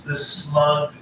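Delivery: ordinary delivery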